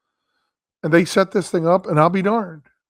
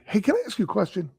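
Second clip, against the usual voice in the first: Larry David impression; 4 semitones higher, 5 dB quieter than usual. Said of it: "like Larry David".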